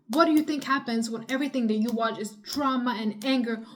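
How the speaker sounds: normal talking voice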